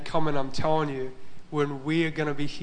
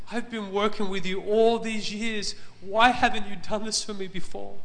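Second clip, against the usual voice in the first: mildly tearful, higher-pitched reproach; imitating tearful plea; 6 semitones higher than usual. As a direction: plead as if through tears, retold. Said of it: "he was crying really hard".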